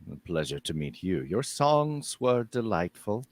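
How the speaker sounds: low, slow stately woman; Mother Saturn. Acted. Mother Saturn greets the bard, low and stately. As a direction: speak with cool dignity.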